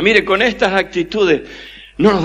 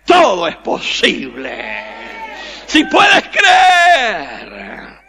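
high, impassioned pulpit shout; full-force preaching; 8 semitones higher than usual. Real, not acted. Preacher urging the congregation to believe, full-force shout. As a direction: preach it at the top of the voice, stretching the key words.

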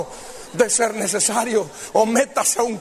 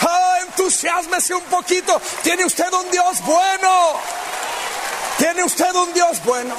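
much higher, shouting to the crowd; excited preaching; 8 semitones higher than usual. Acted. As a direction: fire up the congregation.

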